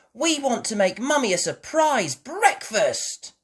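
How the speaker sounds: high, loud eager whisper-plan; excitedly. Acted. Peppa telling Daddy Pig about the surprise, excited. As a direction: bubble with the plan. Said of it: "said Peppa excitedly".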